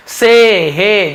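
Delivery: "से, हे" with a long "ay" vowel; mispronounced she, he. Shown as a demonstration. Teacher showing students the misreading, "से, हे" with "ay". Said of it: "तो आप इसे क्या बोल देंगे".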